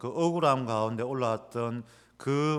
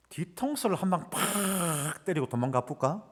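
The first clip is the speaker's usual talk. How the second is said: higher, sly, plotting tone; mischievous inner voice; 6 semitones higher than usual. Retold or imitated. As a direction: scheme like a naughty kid. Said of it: "이런 마음이 훅 올라오는 거예요". (장난스럽게 높은 속마음 목소리)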